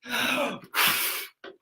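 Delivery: demonstrated big breath in and out; sharp inhale, then blown-out breath; rescue breath. Shown as a demonstration.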